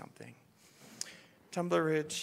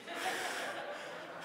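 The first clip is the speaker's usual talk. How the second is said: breathy laugh; 9 dB quieter than usual. Real, not acted. Laughing at own reaction.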